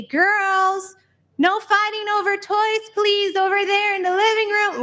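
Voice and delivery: put-on high, sustained call to the children. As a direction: call across the house to scold the kids. Mother calling to kids from kitchen.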